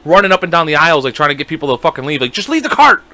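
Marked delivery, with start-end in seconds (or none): 2.3-3.0 much higher yelled order; urgent yell. Retold or imitated. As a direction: hurry the shoppers out with a sharp yell. Retelling employee clearing shoppers.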